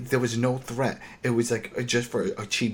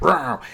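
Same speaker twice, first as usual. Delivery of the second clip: mock zombie roar; 5 semitones higher, 4 dB louder than usual. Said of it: "Just went".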